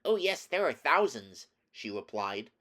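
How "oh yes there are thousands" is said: high, loud little queen voice; mouse queen. A narrator's reading. Mouse queen tells Scarecrow of her many subjects, high mouse voice. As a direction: pipe up high and emphatic.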